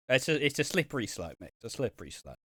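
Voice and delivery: ordinary talk, nothing marked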